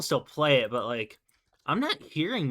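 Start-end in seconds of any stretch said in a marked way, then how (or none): 1.7-2.5 voice crack. Real, not acted. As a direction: voice cracking mid-sentence. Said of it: "That was a voice crack and a half".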